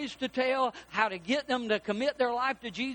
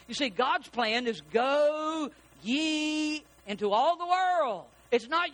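higher, slow pulpit proclamation; preaching; 5 semitones higher, slower than usual. Real, not acted. Preacher declares God's plan to congregation, preaching. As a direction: proclaim it with long, stretched words.

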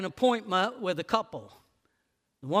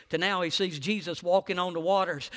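usual speaking voice

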